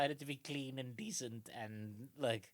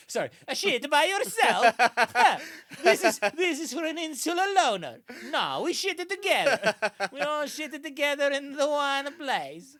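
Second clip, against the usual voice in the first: much higher and much louder, laughing through an affronted aristocrat; snooty Roman; 12 semitones higher, 15 dB louder than usual. Retold or imitated. Romans defending shared latrines, affronted loud sneer. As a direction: scoff like an offended Roman noble. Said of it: "they were like".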